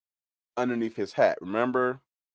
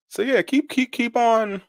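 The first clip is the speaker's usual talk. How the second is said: sarcastic high taunt. Real, not acted.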